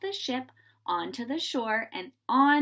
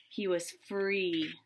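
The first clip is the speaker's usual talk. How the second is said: relieved and soft; 4 semitones lower, 5 dB quieter, much slower than usual. A narrator's reading. George escapes prison.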